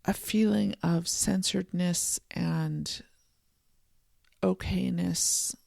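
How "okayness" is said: usual speaking voice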